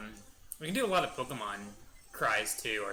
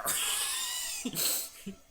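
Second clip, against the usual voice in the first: very high cartoon giggle; squeaky laugh. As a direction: giggle like a tiny cartoon critter. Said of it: "every time I do that voice".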